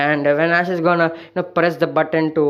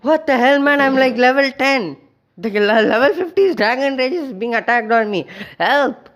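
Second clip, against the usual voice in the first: much higher, louder panicky cry; panicked Pinsir; 7 semitones higher, 3 dB louder than usual. Acted. Outmatched Pinsir crying out under Dragon Rage, higher and louder. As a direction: yelp like an outmatched bug begging for help. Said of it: "Pinsir's like".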